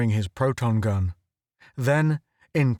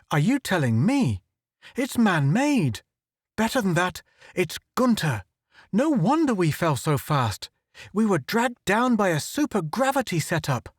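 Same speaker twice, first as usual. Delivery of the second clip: higher, rushed worried talk; agitated Click; 7 semitones higher than usual. Acted. Photographer explaining the trap, higher and agitated. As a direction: pile the words on in growing alarm.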